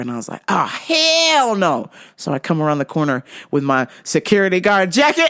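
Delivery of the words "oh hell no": drawn-out, higher indignant outburst; outraged refusal. Retold or imitated. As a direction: stretch it out in mock outrage. Acted outraged reaction to yelling.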